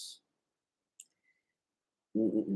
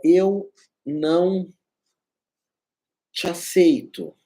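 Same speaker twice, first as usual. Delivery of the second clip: very slow, firm refusal with a long pause; convinced refusal; slower than usual. Shown as a demonstration. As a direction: say it word by word, with conviction. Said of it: "Então, é um não convicto".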